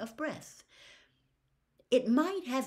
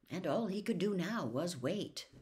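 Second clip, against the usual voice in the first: plain reading voice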